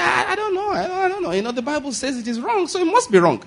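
high, soft, wavering imitation; hesitant interviewee. Preacher mimics Joel Osteen's interview, hesitant and high. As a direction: mimic his unsure answer in a small high voice. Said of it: "He said".

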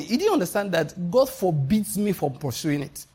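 ordinary talk, nothing marked

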